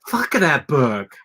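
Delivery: emphatic, drawn-out disgusted outburst; venting hatred. Real, not acted. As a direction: spit it out slowly, really hating the book.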